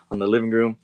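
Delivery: normal talking voice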